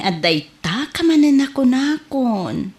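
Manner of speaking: usual speaking voice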